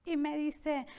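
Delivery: ordinary talk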